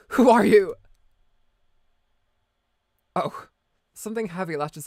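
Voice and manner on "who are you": loud. Acted. Startled character calls out.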